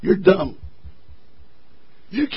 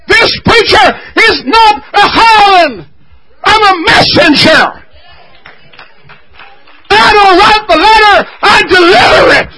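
high, loud whooping preacher cadence with a cry in the voice; preaching at full force; 13 semitones higher, 9 dB louder than usual. Acted. Preacher whooping to the congregation, high and loud. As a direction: lift the church with a shouted, sung-out refrain.

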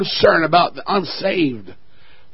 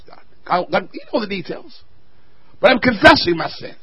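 normal talking voice

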